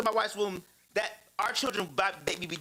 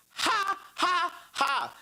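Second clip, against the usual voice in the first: high mocking ha ha ha; son's taunting laugh; 11 semitones higher, much slower than usual. Little son laughing at his hurt dad, high mocking laugh. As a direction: cackle it like a cheeky little boy. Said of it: "he does it".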